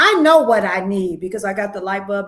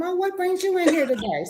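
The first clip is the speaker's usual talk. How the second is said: friendly small talk; 7 semitones higher than usual. Pretend. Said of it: "maybe walk a little bit with 'em".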